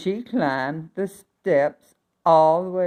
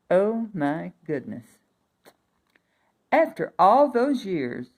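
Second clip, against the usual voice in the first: ordinary delivery, nothing marked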